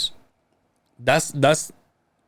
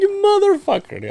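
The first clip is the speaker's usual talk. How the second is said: much higher mock outrage. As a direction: act playfully outraged. Retold outraged reaction to friend.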